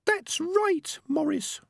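tiny, very high-pitched squeak; high squeaky creature voice. A narrator's reading. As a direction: squeak it like a little talking rat. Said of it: "said the squeaky voice".

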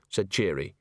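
ordinary delivery, nothing marked

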